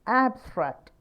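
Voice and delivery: Abstract with a high, loud first syllable, then falling; teacher's careful demo of abstract, first syllable stressed. Shown as a demonstration. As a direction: punch the opening AB of abstract. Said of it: "ആദ്യത്തെ".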